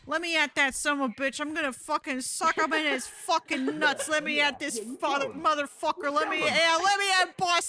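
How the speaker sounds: hammed-up high battle cry; mock fury. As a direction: fake rage. Acts out charging at the boss.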